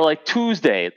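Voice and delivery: normal talking voice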